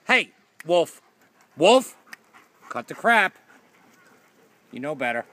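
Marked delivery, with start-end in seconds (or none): 0.1-3.4 raised, high-pitched reprimand; loud scolding. Real, not acted. Owner telling Wolf to cut the crap, scolding loudly. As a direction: call the dog sharply to order.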